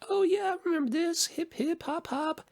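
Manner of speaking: hammed-up excited wedding guest; mock dance-floor crowd. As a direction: mimic partygoers chanting along to the song. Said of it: "they're like".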